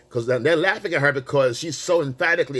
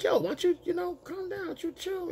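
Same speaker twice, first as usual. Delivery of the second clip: high-pitched, softer imitation; imitated friends; 6 dB quieter than usual. Her friends urging her to calm down, high hushed imitation. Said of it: "her friends were like".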